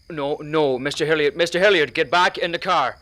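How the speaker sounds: loud, rising alarm calling him back; urgent shout. Acted. Driver shouting at Mister Hilliard to get back in the car.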